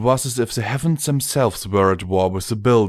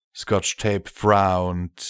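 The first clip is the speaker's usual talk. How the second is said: ordinary delivery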